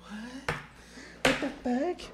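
mock bewilderment. Ex hearing the sleepy outburst, softly mock bewildered. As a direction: imitate someone stunned on the phone. Listener imagines the ex's shocked reply.

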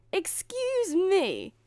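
high, loud, drawn-out indignation; huffy. A narrator's reading. Fairy godmother offended by doubt, in a huff. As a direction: stretch it out, thoroughly affronted. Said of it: "said the fairy godmother in a buff".

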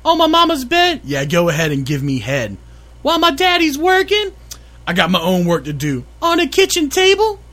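put-on high girly questions, man in falsetto; girl voice. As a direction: squeak the questions like a teenage girl. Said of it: "I get all the girl parts".

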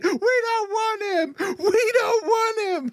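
shrill high mock scream, laughing; high-pitched screaming. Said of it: "Sinbad saying".

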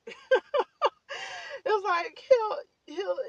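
bursting into delighted laughter mid-sentence; real laughter. Real, not acted. Telling her audience about God's surprises, laughing. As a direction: laugh through the words, slow and loud.

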